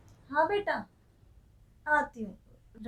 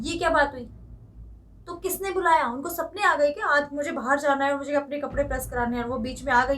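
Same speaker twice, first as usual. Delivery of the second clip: angry rant; 2 semitones higher, 5 dB louder, faster than usual. Acted. Daughter-in-law ranting at her mother-in-law about the guest, loud and fast. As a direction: rant at her without letting her speak.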